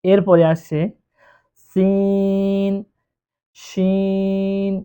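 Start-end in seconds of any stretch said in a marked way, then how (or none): 1.7-4.7 সিন and শিন with very long held vowels; long sin and shin. Shown as a demonstration. Teacher showing the class long সিন and শিন. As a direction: stretch সিন and শিন slowly. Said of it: "তিন আলিফ পরিমাণ টান দিয়ে পড়ব".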